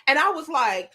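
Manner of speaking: normal talking voice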